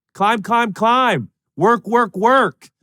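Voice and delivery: high, loud coach bark; football coach. Retold or imitated. Preacher mimicking a football coach urging the team on, high and loud. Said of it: "Football coach vibes".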